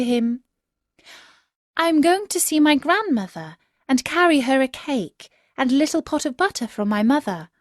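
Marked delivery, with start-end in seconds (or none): 1.7-7.5 put-on higher, fast and eager child voice; little girl's voice. Girl telling the wolf her errand, eager child voice.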